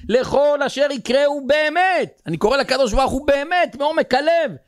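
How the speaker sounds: high, emphatic sermon delivery; impassioned preaching. Real, not acted. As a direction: preach it with rising force, pitch held high.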